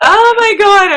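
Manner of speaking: real tears, high and loud; overwhelmed, voice breaking; sobbing out an oh my God; crying. Real, not acted. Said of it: "I'm seriously crying".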